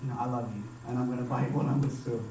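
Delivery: low, soft, imitated motherly affection; loving mom. Mom rewarding her child for passing the test, softly affectionate. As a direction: voice a mother's quiet, tender approval. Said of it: "my mom was like".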